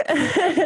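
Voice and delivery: short laugh climbing high; a real laugh that rises; rising laugh. Real, not acted. Laughing while recounting her interview. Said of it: "started laughing".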